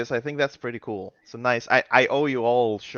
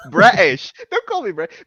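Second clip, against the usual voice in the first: hammed-up offended repeat, higher and louder; mock offended; 8 dB louder than usual. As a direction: play the offended Yorkshireman, laughing. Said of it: "He would get so offended".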